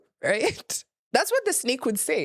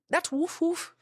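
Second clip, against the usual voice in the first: mock dog woof; 8 semitones higher, slower than usual. Imitating a dog's bark.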